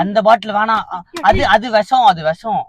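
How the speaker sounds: loud, high-pitched frantic warning; alarmed warning. Pretend. Son stops mother taking the bottle, alarmed shouting. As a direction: cry out urgently to stop someone.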